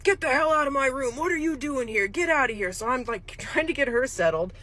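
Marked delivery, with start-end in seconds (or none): 0.0-2.7 hammed-up bark to get out; retelling a resident yelling at an intruder; retold angry yell. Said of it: "starts yelling".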